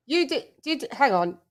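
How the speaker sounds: ordinary talk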